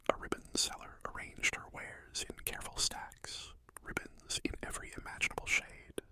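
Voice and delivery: usual speaking voice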